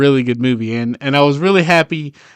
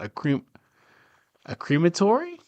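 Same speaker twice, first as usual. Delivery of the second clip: questioning, higher and softer, rising at the end; unsure of the word; 5 semitones higher, 6 dB quieter, faster than usual. Real, not acted. Vlogger searching for what it's called, tentative rising question. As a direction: check a half-remembered word tentatively.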